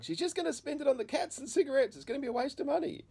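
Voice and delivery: high-pitched imitation of an objection; protesting wife. Wife doubts giving mum the money, acted high-pitched protest.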